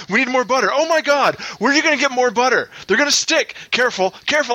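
high, frantic, nonstop panicked backseat nagging; frantic nagging husband. Acted. Husband bursting into the kitchen, frantic high nagging. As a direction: fuss over every egg like a panicked passenger. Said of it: "I was getting too loud".

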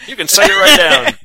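put-on high, loud, brash Boston accent; mock Boston tough guy. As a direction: bark it like a rowdy Boston local.

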